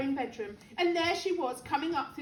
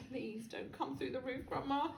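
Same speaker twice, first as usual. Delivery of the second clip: much softer pleading; hushed prayer; 10 dB quieter than usual. A narrator's reading. George praying the roof is spared, hushed plea. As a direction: pray it under your breath. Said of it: "he prayed".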